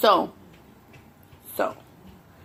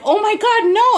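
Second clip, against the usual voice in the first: much higher, louder exasperated cry; exasperated yell; 12 semitones higher, 7 dB louder than usual. Retold or imitated. Retelling yelling at him on the phone, high and loud. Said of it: "And I'm like".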